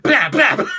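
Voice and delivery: loud, punchy mouth gunshots; mock gunshots. Fan imitating a rooftop sniper, loud mouth shots. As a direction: fire two quick shots with the mouth. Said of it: "sniper rifle ... talking about".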